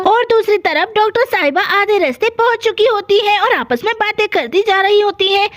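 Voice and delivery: ordinary delivery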